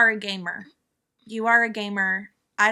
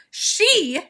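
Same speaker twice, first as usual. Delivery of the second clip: usual speaking voice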